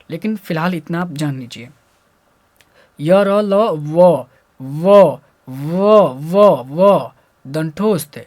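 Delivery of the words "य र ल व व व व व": य, र, ल, व projected loudly, then व repeated; teacher punching out व as a lone sound; letter sound demo. Shown as a demonstration. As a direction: drill व loud and separately, over and over. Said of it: "जब हम इनकी उच्चारण करेंगे".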